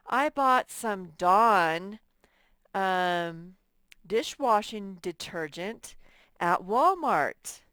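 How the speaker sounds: "Dawn" and "Walmart" stretched out slowly; slow detailed version. Shown as a demonstration. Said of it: "I could say".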